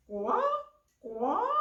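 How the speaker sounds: loud croaked calls, rising; frog. Acted. Frog spots the fish among the reeds, croaking.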